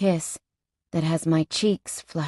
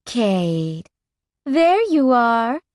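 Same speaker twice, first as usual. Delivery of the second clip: slow, higher sultry woman's call; sultry female voice; 5 semitones higher, 3 dB louder, much slower than usual. Acted. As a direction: purr it out slowly, like a biker chick. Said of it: "a sexy female voice fills my ears".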